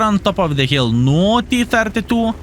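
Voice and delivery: usual speaking voice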